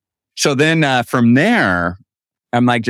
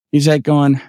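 usual speaking voice

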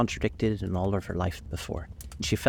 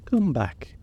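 called out, a bit higher; calling after; 8 semitones higher, 3 dB louder than usual. A narrator's reading. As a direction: call her back across the way. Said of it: "called after her".

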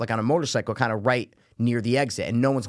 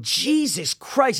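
exasperated; 8 semitones higher, much slower than usual. Retold or imitated. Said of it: "I'm going".

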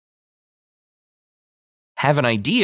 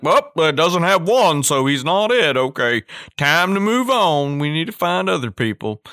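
dismissive mock diagnostician; 8 semitones higher, 4 dB louder than usual. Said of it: "So like".

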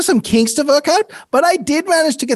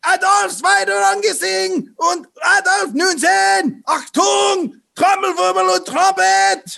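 high barked German orders; German commands; 8 semitones higher than usual. Acted. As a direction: call out like a drill officer in German.

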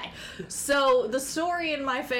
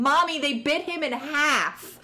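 higher, drawn-out mock yell to mommy; retold child's yell; 6 semitones higher, 3 dB louder, slower than usual. Small child in a theater calling to mommy, mock yelling. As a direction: imitate an excited little kid yelling, half full volume. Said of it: "jumping up and down in my seat yelling".

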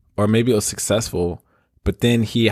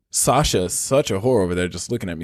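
ordinary talk, nothing marked

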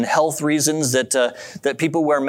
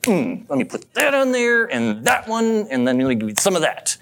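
mock huffy server, starting higher; a little frustrated; 7 semitones higher than usual. Frustrated server preparing a customer's food, mock irritated. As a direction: act out curt irritation while slapping food together. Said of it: "they're just a little frustrated".

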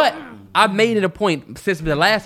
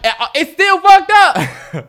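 laughing protest; 11 semitones higher, 7 dB louder, much slower than usual. Real, not acted.

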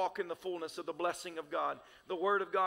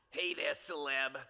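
Bronx lady voice; 4 semitones lower, slower than usual. Retold or imitated. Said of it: "She always said, call my name celeb".

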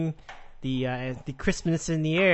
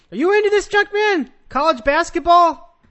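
higher and louder, calling out eagerly; excited calling out; 12 semitones higher, 9 dB louder than usual. Real, not acted. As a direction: call across to him, fired up. Calling out to co-host.

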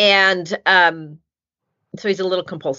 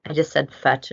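usual speaking voice

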